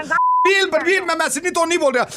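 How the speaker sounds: high squeaky put-on voice calling Balbir; shrill-pitched fake caller voice; squeaky prank voice. Prank caller to cricketer, high squeaky put-on voice.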